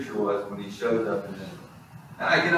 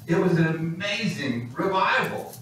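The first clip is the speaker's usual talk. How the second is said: usual speaking voice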